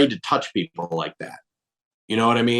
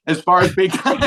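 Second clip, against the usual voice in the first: laughing; 6 semitones higher, faster than usual. Real, not acted. Tries again, laughs again.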